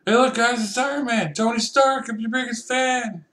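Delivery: high, loud fanboy gush; excited fan. Acted. Starstruck fan greeting Iron Man excitedly.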